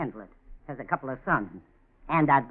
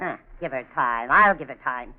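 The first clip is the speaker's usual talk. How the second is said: normal talking voice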